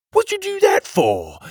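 very high, loud startled outcry; alarmed cry. A narrator's reading. Petey protesting the broken gears, high alarmed cry. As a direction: cry out in dismay at the damage. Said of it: "Petey cried".

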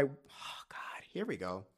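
quiet, choked whisper as tears come; real teary murmur; tearful whisper. Real, not acted. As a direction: breathe it out, fighting tears. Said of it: "Why am I crying?".

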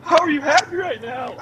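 very high mock-crying voice; high-pitched hammed-up protest; imitated tearful disbelief. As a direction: wail it in bafflement, imitating her. Crying wife's baffled reply, retold.

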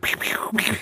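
high, loud "pew pew" gun noises; play-shooting sound effects; imitated gunshots. Imitates gunshots for the guns joke.

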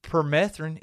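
usual speaking voice

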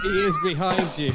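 loud mock audience yell; panto shout. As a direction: call out like a panto crowd.

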